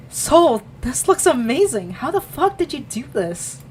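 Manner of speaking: high, loud burst of awe; amazed praise. Acted. You seeing Soul's sketch, amazed praise. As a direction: blurt it out, astonished.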